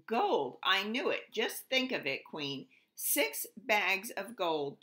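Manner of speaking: trilling greedy king. Acted. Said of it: "trilled the king".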